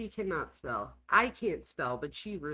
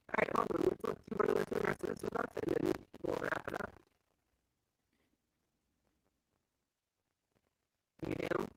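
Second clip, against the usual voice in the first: robotic, glitchy audio; garbled like a bad connection; faster than usual. Real, not acted. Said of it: "but you sound like a robot".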